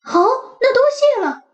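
quick eager little dog; eager puppy. Acted. Max thanks rabbit. (急切的小狗)

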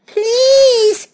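high, stretched puppy plea; crying out. A narrator's reading. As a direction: cry it out high, begging. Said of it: "cried the puppy".

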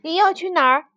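loud, high anxious question. Acted. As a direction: ask with alarm. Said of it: "弗洛格不安地问".

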